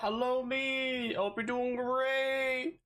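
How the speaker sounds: high, slow sing-song hello; sing-song greeting. Pretend. Streamer greeting viewers in a high sing-song. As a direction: greet them like a cheery kids' host.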